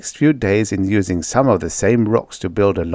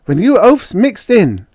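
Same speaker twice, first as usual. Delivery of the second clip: higher, louder, irritated; Judy snapping at the men over the radio; 12 semitones higher, 6 dB louder than usual. Acted. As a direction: snap back at him. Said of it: "Judy snapped".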